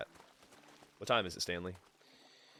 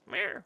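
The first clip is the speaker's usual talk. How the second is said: cat meow; 11 semitones higher than usual. Pretend. Imitating the cat.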